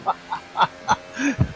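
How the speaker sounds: high, wheezy burst of laughter; amused laugh. Real, not acted. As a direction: crack up after a teasing echo. Laughs after echoing the thanks.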